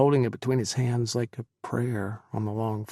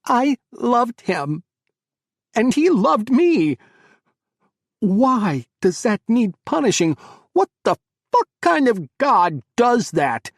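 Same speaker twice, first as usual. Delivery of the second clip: much higher and louder, slow screaming; anguished scream; 12 semitones higher, 11 dB louder than usual. Acted. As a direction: scream the grief at heaven. Chris screams at mother.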